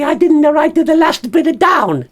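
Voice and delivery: a quick unsure puppet aside; high puppet voice muttering an afterthought; Bert the puppet aside. Pretend. Puppet admits missing notes.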